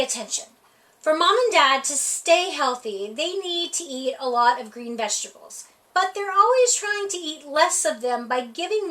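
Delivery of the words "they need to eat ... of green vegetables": ordinary delivery, nothing marked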